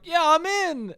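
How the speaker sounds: high, chirpy mock Biden; a hint of a pleased old man; lightly voiced, high eager boast; mock Biden cheer. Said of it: "he's gonna be like".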